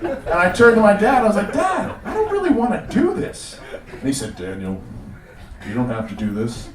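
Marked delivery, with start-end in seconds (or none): 1.5-3.6 put-on high, reluctant child voice; timid six-year-old to his father; little kid. Himself as six-year-old pleading with dad. 4.4-6.7 low, gentle father voice; calm, lowered dad voice; reassuring dad. Acted. Father answering his young son.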